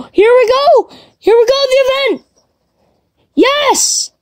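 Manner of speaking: high, bright burst of excitement; thrilled. Real, not acted. Player greets the long-awaited event, high excited cheer. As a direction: cheer as the event finally starts.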